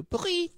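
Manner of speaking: a high squeaky mock cat bleat; terrible cat impersonation. Showing the cat bringing back the toy, a high silly mock cat cry. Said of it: "That was a terrible impersonation of a cat".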